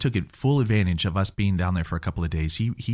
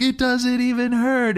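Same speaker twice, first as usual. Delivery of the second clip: put-on high, slow, wondering child's voice; a kid stunned that nothing hurts; amazed kid; 14 semitones higher, 3 dB louder, slower than usual.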